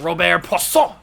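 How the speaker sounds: playful French-accented name. Shown as a demonstration. Showing how Robert Poisson must be said, French accent. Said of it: "You gotta say it with the, with the, the French accent".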